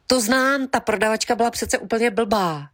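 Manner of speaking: high, eager know-it-all butting in; eager interjection. Retold or imitated. A lady heckling the author's reading, eager high interjection. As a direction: chime in brightly, sure she knows. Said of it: "ozvalo se".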